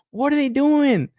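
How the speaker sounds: high-pitched, baffled protest; exasperated disbelief. Real, not acted. Reviewer questions the toymakers' choices, exasperated. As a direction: voice shoots up in incredulity.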